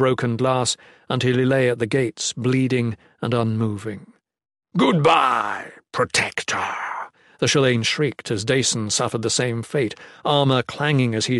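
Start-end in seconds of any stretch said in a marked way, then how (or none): 4.8-6.6 mock farewell in monster voice; taunting monster villain. A narrator's reading. Monster striking down the protector, taunting. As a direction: dismiss the foe with menace.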